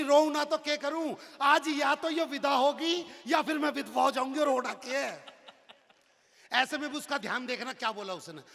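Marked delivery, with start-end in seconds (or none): 0.0-5.2 louder, higher lament; loud, high whining lament; wailing wife. Acted. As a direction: cry it out in comic despair. Wife answers, wailing in despair.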